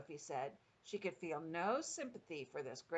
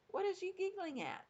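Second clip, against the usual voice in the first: high, slightly nettled question; BFG; 12 semitones higher than usual. A narrator's reading. Giant questions a giggling Sophie, slightly nettled. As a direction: pitch it right up, mildly put out. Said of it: "slightly nettled".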